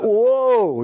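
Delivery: retold jolt of realization at the office door; sudden realization. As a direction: catch yourself mid-step. Stops at door, realizes mistake.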